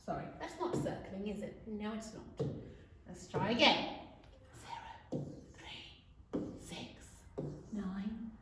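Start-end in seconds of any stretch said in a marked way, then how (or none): 4.6-8.3 hushed count under the pupils' voices; whispered number prompts. Shown as a demonstration. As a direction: whisper each number, leaving gaps. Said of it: "I'll whisper it".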